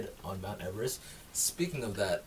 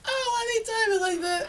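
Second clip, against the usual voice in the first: very high laughing wail; helpless laughter; 3 dB louder than usual. Real, not acted. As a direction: squeal it out while cracking up. Helpless laughter at news clip.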